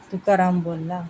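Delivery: ordinary delivery, nothing marked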